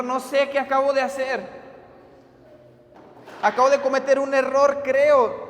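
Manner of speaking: distressed prayer pitched high, with no real sobs; mock tearful prayer. Youth leader confessing a mistake to God, acted tearful. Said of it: "se echó a temblar llorando".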